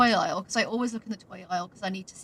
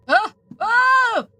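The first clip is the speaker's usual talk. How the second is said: high loud surprised cry; startled yelp; 14 semitones higher, 14 dB louder than usual. Real, not acted. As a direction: jump at a sudden fall. Accidentally hit the void.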